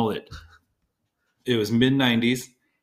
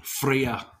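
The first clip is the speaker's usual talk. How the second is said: slight Scottish lilt, a bit higher; Scottish-accented name drop; 4 semitones higher than usual. Real, not acted. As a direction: say it with an accidental Scottish burr. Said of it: "That was a Scottish accent".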